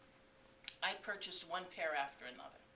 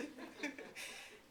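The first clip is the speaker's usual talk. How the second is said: laugh; 9 semitones higher, 5 dB quieter than usual. Real, not acted. Laughing at the memory.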